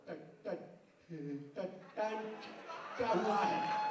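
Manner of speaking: low halting stammer on the repeated टट; stammering policeman. Retold or imitated. Policeman to villager, a stuttering low query. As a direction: stutter through it, stuck on each syllable. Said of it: "police वाला बोला".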